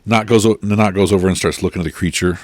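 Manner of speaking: ordinary delivery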